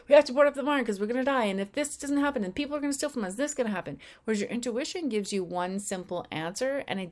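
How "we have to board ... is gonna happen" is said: frantic ego. Retold or imitated. The ego justifying boarding up the barn, frantic. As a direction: rattle off reasons in a frightened hurry. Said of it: "It'll be like".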